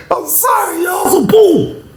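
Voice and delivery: cracking up, voice squeaking high; laughing. Real, not acted. Laughing apology to viewers, voice cracking high.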